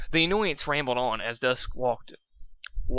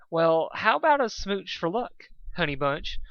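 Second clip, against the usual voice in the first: flirty Pinkie; 6 semitones higher than usual. Acted.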